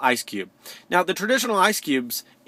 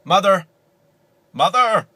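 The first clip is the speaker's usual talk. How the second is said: loud, higher call for mother; 5 semitones higher, 7 dB louder than usual. Acted. Child calling for Mother's attention, loud and raised. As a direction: holler "Mother" like an acted kid.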